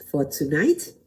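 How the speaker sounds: ordinary talk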